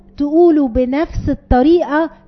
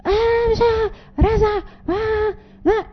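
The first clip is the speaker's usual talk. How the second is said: put-on whining child; 9 semitones higher, 6 dB quieter, slower than usual.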